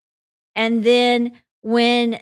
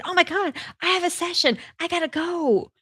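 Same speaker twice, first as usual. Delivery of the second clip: mock sudden alarm; 9 semitones higher than usual. Pretends a session, jumps up.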